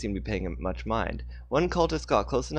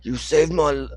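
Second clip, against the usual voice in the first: wrong voice; 6 semitones higher, 7 dB louder than usual. Acted. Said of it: "I'm using the wrong voice here".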